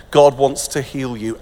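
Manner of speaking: ordinary talk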